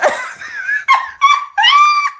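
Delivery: long, high-pitched shrieking laugh; high cackle. Real, not acted. Host cracking up at her own self-soothing song, hysterical laughter. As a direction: burst out in a loud high cackle.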